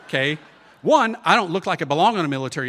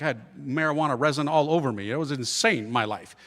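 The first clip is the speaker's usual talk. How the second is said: normal talking voice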